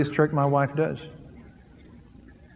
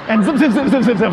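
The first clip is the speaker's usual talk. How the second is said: mock zipper sound; 3 semitones higher, 6 dB louder, faster than usual. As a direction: play out the zipping gleefully, louder at the start. Wife zips the man's zipper.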